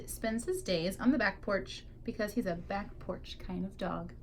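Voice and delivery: ordinary delivery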